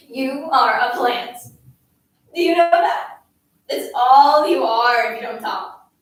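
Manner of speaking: loud, forceful berating; angry tirade. A narrator's reading. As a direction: hammer the silent child with insults and questions. Bully's scolding tirade continues.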